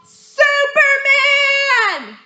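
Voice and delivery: long, high drawn-out shout; stretched sing-song yell. Shown as a demonstration. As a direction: belt it out like spotting a hero in the sky. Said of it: "you're gonna yell".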